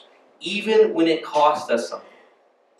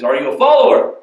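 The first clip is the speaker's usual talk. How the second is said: loud, raised-pitch attempt at an Egyptian accent; bad Egyptian accent; 6 semitones higher, 7 dB louder than usual. Egyptian man challenges a believer, bad Egyptian accent. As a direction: question them in a badly faked Egyptian accent. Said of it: "That's a really bad Egyptian accent".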